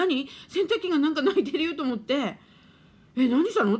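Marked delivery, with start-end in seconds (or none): 3.2-3.8 startled question. Retold or imitated.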